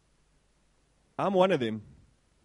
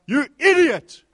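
loud, higher yell at another driver; angry shout; 6 semitones higher, 11 dB louder, slower than usual. Retold or imitated. Preacher retells shouting at driver, loud angry yell. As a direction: bark it at the driver in the wrong lane. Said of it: "and I was like".

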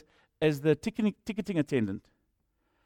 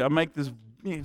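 ordinary delivery

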